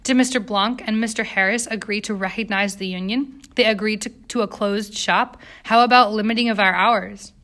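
usual speaking voice

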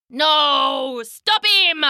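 high, urgent royal cry; panicked king. Acted. King begging guards to stop the duck, high panicked cry. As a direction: call desperately for help in a put-on king's voice.

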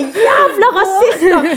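high, dragged-out furious yell through laughter; dad losing it. Retold or imitated. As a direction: belt it out like a dad who just snapped. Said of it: "han klikket og bare".

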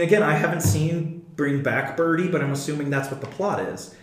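ordinary talk, nothing marked

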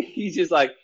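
ordinary delivery

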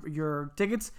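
normal talking voice

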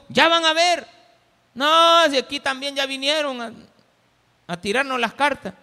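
loud, high mocking outcry; scoffing crowd. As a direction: call it out like a scornful doubter. Acts out the scoffing doubting crowd.

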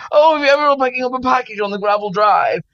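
high, quick, exaggerated posh English; hammed-up posh English voice; mock posh accent. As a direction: send up your own posh English accent. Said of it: "God, I sound so posh".

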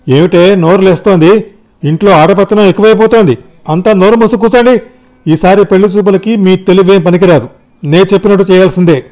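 loud, high, domineering scolding; angry shouting. A narrator's reading. Father ordering family to shut up, angry shouting. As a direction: shout the household into silence. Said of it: "అరిచాడు తండ్రి రామయ్య గారు".

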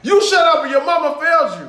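high, loud imitation of firing back; mock angry comeback. Host imitating fighter answering a heckler, mock angry comeback. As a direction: throw the retold insult back hard.

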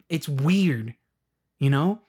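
normal talking voice

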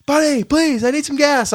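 very high, desperate begging; pleading customer. Retold or imitated. Late customer begging the pumper for gas, high pleading. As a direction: beg for help like a helpless guy. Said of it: "He's like".

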